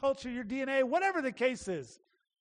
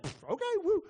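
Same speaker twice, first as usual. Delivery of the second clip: high, offhand pff and an easy okay; casual shrug; 8 semitones higher than usual. Retold or imitated. Peter casually accepting Jesus's call, offhand. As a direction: shrug it off like Peter taking the invite lightly. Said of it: "And Peter's like".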